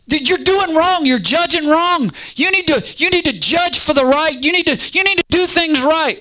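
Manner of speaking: very high, strained wailing complaint; high-pitched, accusing outburst; crying out. Acted. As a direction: nag God in a high, desperate cry. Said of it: "why they cry out in the way that they do".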